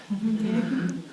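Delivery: soft, high giggle; laugh. Real, not acted. Speaker joking about plastic and stones, soft high giggle. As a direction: giggle quietly at your own joke.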